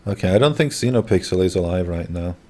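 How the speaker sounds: ordinary talk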